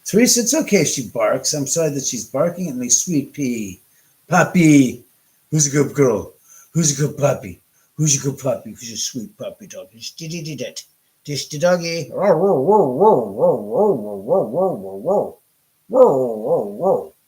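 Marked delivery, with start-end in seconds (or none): 2.9-12.1 loud sing-song puppy talk; talking to a dog. Real, not acted. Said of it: "Talking to the dog, I swear". 12.1-17.1 loud playful mock barking; talking to a dog. Real, not acted. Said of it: "Talking to the dog, I swear".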